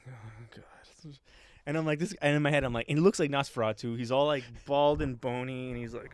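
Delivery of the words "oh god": very soft creepy whisper; whispered, barely there; eerie whisper. Retold or imitated. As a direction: imitate a ghoul under your breath. Imitating the eerie CEO.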